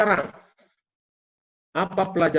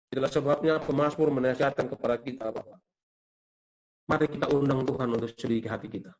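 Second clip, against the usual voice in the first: normal talking voice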